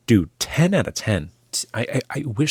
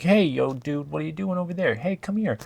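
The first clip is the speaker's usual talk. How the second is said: casual actor calling out; 7 semitones higher than usual. Retold or imitated. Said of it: "the actors will be talking like this".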